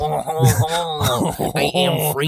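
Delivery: hammed-up, drawn-out French accent. Shown as a demonstration. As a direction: stretch it out in mock French. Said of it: "And here's French".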